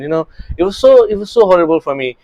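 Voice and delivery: usual speaking voice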